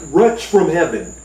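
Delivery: ordinary delivery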